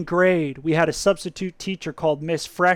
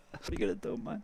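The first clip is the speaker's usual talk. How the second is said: whispered resigned shrug; 10 semitones lower, 18 dB quieter, faster than usual. Real, not acted. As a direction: breathe it out softly.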